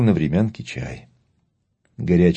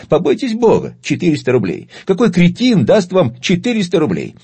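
high, loud market haggler's outcry; haggling dealer tone; 11 semitones higher, 5 dB louder than usual. Acted. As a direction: play the outraged bazaar dealer. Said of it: "воскликнул Бабель тоном перекупщика". (тоном перекупщика, возмущённо и громко)

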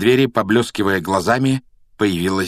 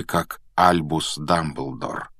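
plain reading voice

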